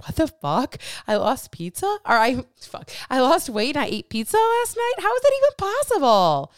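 mock-baffled, building to a shocked squeal; disbelieving outburst. Coach imitating a confused client, rising to shocked disbelief.

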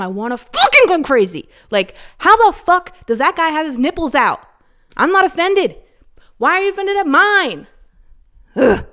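riled up rant. Real, not acted. Podcaster ranting to listeners about nipple rules, heated and loud. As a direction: vent the unfairness hot and fast. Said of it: "I got all riled up there".

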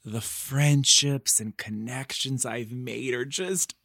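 usual speaking voice

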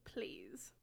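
tiny whispered begging; whispered plea. Acted. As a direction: plead under your breath. Pleading with everyone to join.

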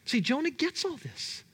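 ordinary talk, nothing marked

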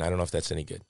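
ordinary delivery

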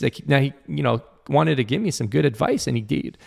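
usual speaking voice

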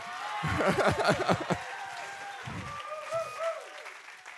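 a burst of high delighted laughter; joyful high laugh. Real, not acted. Joyful laugh at answered prayer.

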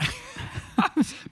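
high burst of real laughter. Real, not acted. Husband laughs at wife's apology.

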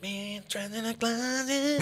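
high, slow put-on voice; mock goofy voice. Quoting the guy to friends, high slow goofy impression. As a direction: stretch it out in a silly high voice. Mimicking the guy's odd phrase.

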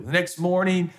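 normal talking voice

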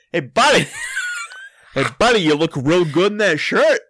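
high, loud teasing holler; mock yelling. Man replaying his call to the wrong guy, mock yelling. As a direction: yell a compliment across at a buddy. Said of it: "I thought I was yelling to my friend".

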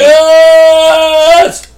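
high loud shout of victory; whooping yes; triumphant cheer. Acted. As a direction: yell it like you won. Player cheering in character.

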